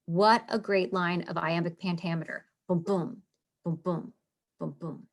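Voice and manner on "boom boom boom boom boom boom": soft thumping boom boom, fading lower and quieter; heartbeat sound. Retold or imitated. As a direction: mimic a heartbeat fading away. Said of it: "having that heartbeat sound".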